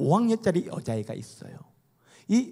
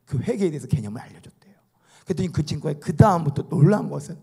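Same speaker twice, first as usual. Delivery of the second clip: ordinary talk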